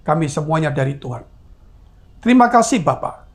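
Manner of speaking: measured, even public prayer cadence; pastoral prayer. Real, not acted. Pastor prays to God for the churches, steady prayer. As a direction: pray aloud in long, steady phrases.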